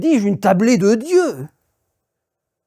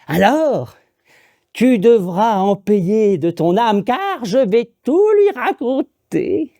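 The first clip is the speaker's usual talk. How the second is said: put-on slow, menacing old witch voice; slower than usual. Witch threatens the woodcutter who ate the devil's meal, slow and menacing. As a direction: draw out each threat like a curse. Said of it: "elle lui fait".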